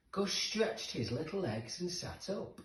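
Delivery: ordinary delivery, nothing marked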